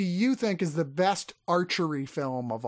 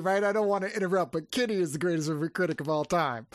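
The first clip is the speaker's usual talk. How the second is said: ordinary talk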